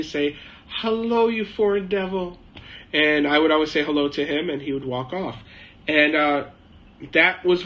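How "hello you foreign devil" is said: bright higher, smiling; smiling old man's insult. Retold or imitated. Old man greeting the foreigner with a smiling insult. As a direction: say the insult sweetly with a smile. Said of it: "this old man would always smile at me".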